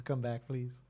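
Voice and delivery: usual speaking voice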